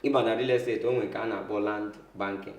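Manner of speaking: ordinary talk, nothing marked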